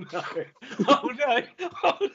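ordinary talk, nothing marked

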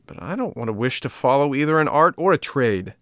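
put-on high, loud childish objection; puppet's indignant refusal; whining protest. A narrator's reading. Puppet protests against having to work.

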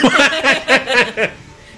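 loud high laugh at his own punchline; burst of laughter. Real, not acted. Laughing at the punchline about Jesús. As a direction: crack up laughing.

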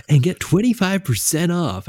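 high, bright mock ad-read voice; Jimmy impression. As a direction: ham up his co-host's peppy ad read. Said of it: "Was that your Jimmy impression?".

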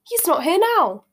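loud, high mock amazement. A narrator's reading. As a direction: pretend to be astonished, swooping up high. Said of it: "Harry looked mockingly".

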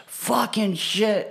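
imitated angry cussing, stretched slow; mock frustrated outburst. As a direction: blurt it out like a fed-up coworker losing it. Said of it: "I just hear him go".

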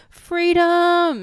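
high, loud cry of freedom; dog's joyful cry. Retold or imitated. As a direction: shout it as the running dog. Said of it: "he was just like".

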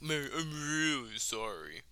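slow, hollow mock apology; insincere apology. Mocking the player's apology, slow and hollow. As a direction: drag it out, clearly not meaning it. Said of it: "sounded like just a statement".

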